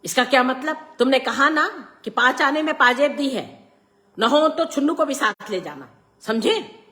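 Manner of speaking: loud, high-pitched insistent scolding; stern rebuke. A narrator's reading. Father overruling his son about the anklet, stern rebuke. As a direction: come down hard on the objection.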